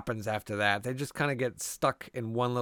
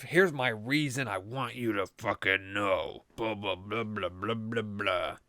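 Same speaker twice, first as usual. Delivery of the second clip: lightly voiced villain droning on, a bit lower and softer; mock villain monologue; 4 semitones lower, 4 dB quieter than usual. Podcaster mocking a Scream villain's monologue, low and droning. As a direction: brush off the killer's speech as blah. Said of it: "It's the big motive".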